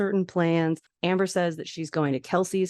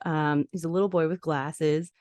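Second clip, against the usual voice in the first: ordinary talk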